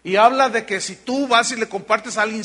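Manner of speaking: ordinary delivery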